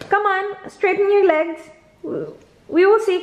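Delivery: usual speaking voice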